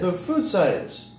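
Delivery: high, slow announcer. Acted. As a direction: announce it grandly. Mock grand announcement, slow.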